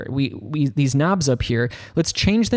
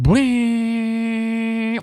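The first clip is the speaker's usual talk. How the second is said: high, long sustained ringing "bling"; imitated chord strum; 8 semitones higher than usual. Shown as a demonstration. Teacher showing a strummed chord, imitated ringing. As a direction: sing out a held guitar-chord noise.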